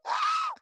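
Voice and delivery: very high squeaky yelp of pain; imitated pained yelp. Mimicking the student's cry after a body shot, high yelp. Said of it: "that was the noise he would make".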